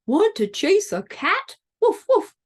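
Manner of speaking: high, yappy dog talk; barking dog voice. Acted. Dog invites spider to chase a cat, high barking voice. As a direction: ask like an eager dog, ending in woofs. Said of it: "barked the dog".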